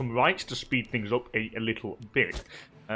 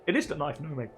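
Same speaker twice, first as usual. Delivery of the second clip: squeaky, strained sore throat; 8 semitones higher than usual. Real, not acted. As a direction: voice cracking high from a sore throat. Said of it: "I've got a bit of a kind of sore throat".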